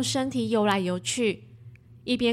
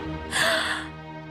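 frightened high gasp; 4 dB quieter than usual. Acted. Shark news shock.

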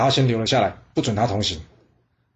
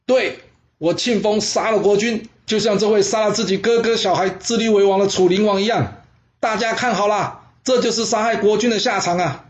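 defiant loud shout; 7 semitones higher than usual. Acted. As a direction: shout back at the king in defiance. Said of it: "庆丰大声的回他说". (庆丰在刑场上高声反驳楚灵王，大声怒斥)

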